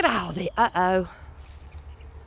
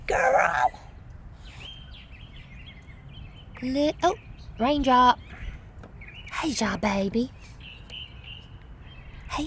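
much higher calling pet voice; 6 semitones higher than usual. Real, not acted. Owner calling her cat in the garden, high and sweet. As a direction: call up sweetly to your cat.

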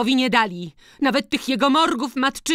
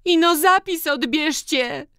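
very high, strained tearful cry; through tears; 6 dB louder than usual. A narrator's reading. Tearful daughter-in-law demanding the deed back, high and upset. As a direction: throw it in loudly through tears. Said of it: "dorzuciła przez łzy Hanka".

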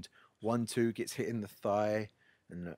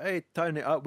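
mock call-out; 6 semitones higher, 5 dB louder than usual. Mate calls out in the bar.

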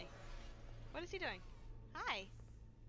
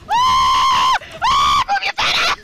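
panicked scream, loud and high; panicking gamer; 11 semitones higher, 16 dB louder, slower than usual. Real, not acted. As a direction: yell in terror at the screen. Jumpscare panic in game.

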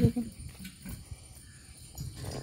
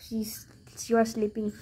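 whisper; 5 dB quieter than usual. Real, not acted. Cat asleep nearby.

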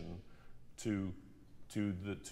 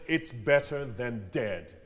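"it's better than dead" slow and louder, spaced out; timed delivery; 6 dB louder, slower than usual. Shown as a demonstration. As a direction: place each word with timing. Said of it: "You have to time that kind of thing".

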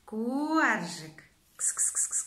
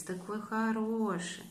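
usual speaking voice

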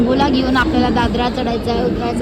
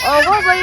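नाटकी रडणं; 6 semitones higher than usual. Retold or imitated. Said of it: "कशी रडते?".

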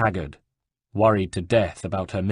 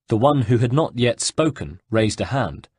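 ordinary delivery, nothing marked

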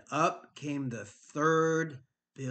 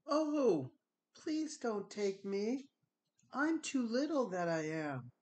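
high, soft pleading little goat; tiny goat voice; 10 semitones higher, 10 dB quieter than usual. Acted. Tiny goat begs troll.

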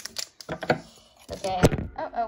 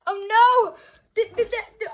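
overwhelmed laugh; 8 semitones higher, 4 dB louder than usual. Real, not acted.

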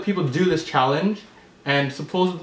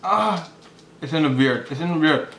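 peeling mask off chin; through a face mask. Real, not acted. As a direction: stiff mask around lips. Seen 1: grey peel-off mask covers face; hands pull it at chin throughout.

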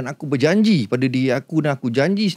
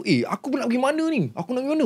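high, frightened self questioning; mild retold panic; 9 semitones higher, 4 dB quieter than usual. Floating man asks himself in mild panic. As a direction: ask yourself where you are going, lost and scared.

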